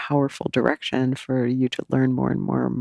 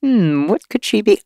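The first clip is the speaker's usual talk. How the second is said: musing aloud; 6 semitones higher than usual. Retold or imitated. Daughter working out a job for her mom, pondering.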